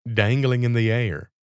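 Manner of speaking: plain reading voice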